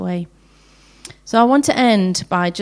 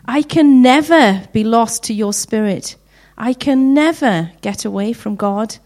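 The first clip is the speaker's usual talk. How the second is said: a louder, swelling Psalm reading; scripture reading; 6 dB louder than usual. Acted. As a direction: read the Psalm aloud and let it build. Reads Psalm 139 aloud.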